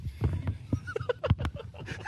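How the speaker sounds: breathy laugh. Real, not acted.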